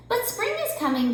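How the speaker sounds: high chirpy bird voice; lightly voiced chirp of a bird. A narrator's reading. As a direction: chirp it up brightly. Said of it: "Bird chirped".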